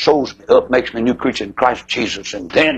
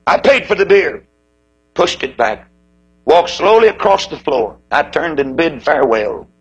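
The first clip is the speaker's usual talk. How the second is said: ordinary talk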